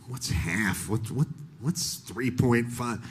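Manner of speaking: baffled mutter over the trash can; muttering to himself. Retold or imitated. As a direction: count it out under your breath.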